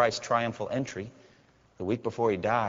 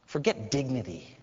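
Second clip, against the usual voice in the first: normal talking voice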